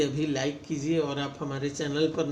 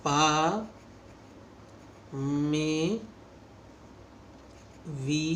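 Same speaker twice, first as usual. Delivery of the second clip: "pa may we all go to" one word at a time, long pauses between; 6 dB quieter, much slower than usual. Shown as a demonstration. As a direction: spell it out word by word. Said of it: "long vowel में". (हर शब्द अलग-अलग, रुक-रुककर)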